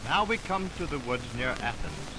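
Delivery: ordinary talk, nothing marked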